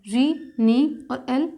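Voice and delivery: री, नी और एल drawn out, sound by sound; slow spelling. Shown as a demonstration. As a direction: break it into री, नी, एल slowly. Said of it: "new, अल". (धीरे-धीरे, अक्षर-दर-अक्षर)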